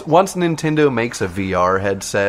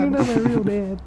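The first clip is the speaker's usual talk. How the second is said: put-on high whiny child echo; petulant kid voice; 13 semitones higher than usual. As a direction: throw it back like a sulky kid. Echoing the kid line.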